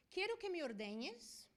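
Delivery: ordinary talk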